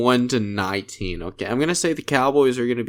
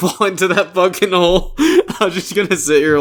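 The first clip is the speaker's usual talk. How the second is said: higher-pitched, real laughter through the words; laughing; 8 semitones higher, 5 dB louder than usual. Real, not acted. Co-host admitting he drifts to an old Super Bowl, laughing. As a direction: laugh while confessing it. Teasing himself about a painful memory.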